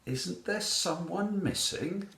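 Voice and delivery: high questioning lilt; inquiring cat voice. A narrator's reading. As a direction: ask lightly in a high voice. Cat asks at the tea party.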